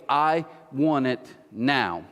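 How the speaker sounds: "I want it now", slowly, word by word; slow emphatic demand. Acted. Preacher repeating the point to the congregation, slow and emphatic. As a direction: separate "I", "want it" and "now" with weighty pauses.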